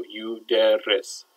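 "yuderis" slowly, syllable by syllable; three syllables. Shown as a demonstration. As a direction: break "yuderis" into yu, der, ris at half speed. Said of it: "three syllables".

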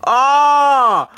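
high, loud sudden cry; startled yelp. Real, not acted. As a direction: yelp in surprise. Camera turned wrong way.

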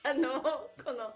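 laughing. Real, not acted. Nervous laughing.

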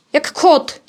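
ordinary talk

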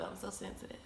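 barely audible, fighting real tears; tearful. Real, not acted. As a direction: let it shrink to a tearful murmur. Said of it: "I don't even know why I'm crying".

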